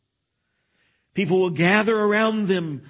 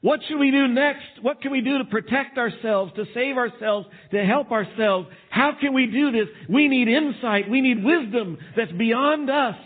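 higher, pleading questions of worried people; anxious people asking; 7 semitones higher than usual. Retold or imitated. Preacher voicing frightened people asking what to do, pleading. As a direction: voice the desperate crowd begging for answers. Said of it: "people are gonna wanna know".

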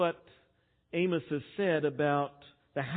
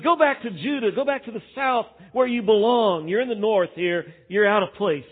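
higher, louder mocking send-off; a priest mocking the southern prophet, scornful; scornful taunt; 6 semitones higher, 9 dB louder than usual. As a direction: shoo him back south with contempt. Priest taunting Amos to leave.

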